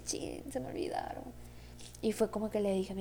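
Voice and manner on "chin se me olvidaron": breathy whispered curse at herself; whispered dismay. Retold or imitated. Retelling forgetting the house keys, whispered dismay. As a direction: whisper it like realizing your mistake.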